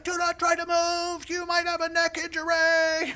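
high, loud shout from afar; imitating a rescuer yelling as he runs up; yelling paramedic. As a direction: holler a warning across a distance. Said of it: "he's still yelling as he comes".

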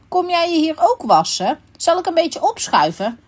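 high, kindly character voice; very friendly. A narrator's reading. Raccoon inviting a stranger to wash, high and friendly. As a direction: offer it sweetly, pitched well up. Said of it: "die vroeg hem heel aardig".

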